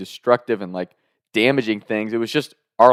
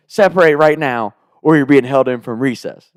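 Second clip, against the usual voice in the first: louder, sharper lunch-lady threat; stern threat; 3 semitones higher, 6 dB louder than usual. Retold or imitated. Lunch lady threatening to hold the boys in, loud. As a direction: threaten them with losing recess.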